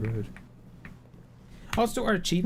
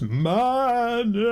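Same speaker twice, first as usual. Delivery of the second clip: higher, louder joyful shout; shouted climax; 9 semitones higher, 5 dB louder than usual. Pretend. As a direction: belt the last word out. Climax of the chant.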